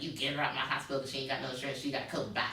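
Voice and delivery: fast, dismissive doctor kicking her out; brushing off a sick patient, fast and curt; mock rude doctor. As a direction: rattle it off like a doctor who doesn't care. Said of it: "Doctor Charles was like".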